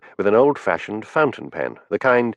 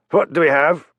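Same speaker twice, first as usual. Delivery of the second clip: lightly voiced Glasgow accent, higher and demanding; a hint of a truculent Glasgow inspector barking a question; heavy Glasgow accent; 8 semitones higher, 4 dB louder than usual. A narrator's reading. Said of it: "in his heavy, truculent Glasgow accent".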